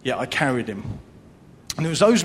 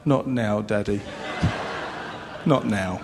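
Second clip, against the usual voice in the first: daughter's hushed reply; 6 semitones lower than usual. Retold or imitated. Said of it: "she looked at me and just like".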